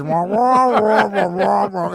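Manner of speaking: mumbled wah wah. Retold or imitated. Tuning out the clerk, mock wah-wah droning. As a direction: make his talk a meaningless wah-wah drone. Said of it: "all I hear is".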